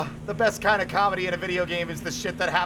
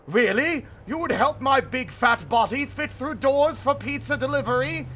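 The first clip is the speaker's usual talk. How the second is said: higher, eager monster voice; hammed-up monster delight; hopeful monster; 7 semitones higher than usual. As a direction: gasp in hope like a giant. Monster surprised.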